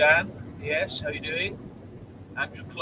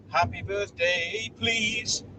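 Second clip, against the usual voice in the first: drawn-out, rising, higher-pitched greeting; birthday shout-out; 6 semitones higher, much slower than usual. Pretend. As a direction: stretch it out playfully, climbing to a high "please". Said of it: "Here you go".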